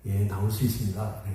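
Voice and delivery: calm, restrained acceptance masking joy; hiding his joy. Retold or imitated. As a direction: play it cool, hide the excitement. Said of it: "기쁨을 감추고".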